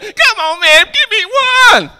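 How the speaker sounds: high, slow, pleading whine with a laugh; begging men. Retold or imitated. Street men pleading with the preacher, high pleading whine. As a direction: beg for it like your life depends on it.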